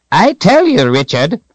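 put-on high, loud old wizard's voice; old man. A narrator's reading. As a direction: protest in a high old man's pitch. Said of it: "the old man said".

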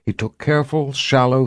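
ordinary delivery

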